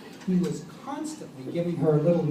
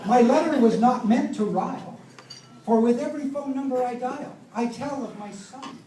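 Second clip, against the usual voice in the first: poem recited; 4 semitones higher than usual. Retold or imitated. Reciting son's letter in verse.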